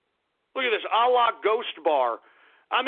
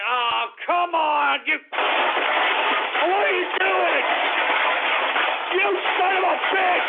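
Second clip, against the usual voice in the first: high-pitched, louder furious yelling; outraged shouting; 9 semitones higher, 3 dB louder, slower than usual. Acted. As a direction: blow up at the trolls. Outburst at troll Twitter names.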